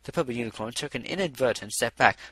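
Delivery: ordinary delivery, nothing marked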